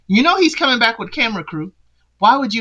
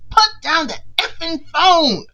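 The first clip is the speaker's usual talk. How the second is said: mock Tia demand; 8 semitones higher than usual. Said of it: "when she says she wants to talk".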